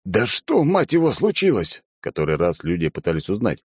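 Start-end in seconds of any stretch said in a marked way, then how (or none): 0.1-1.8 exasperated. A narrator's reading. Exasperated question.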